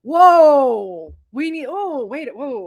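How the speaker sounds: loud drawn-out whoa, then easing; mock alarm. Viewer balking at the god's exposition, exaggerated alarm. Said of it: "And I was".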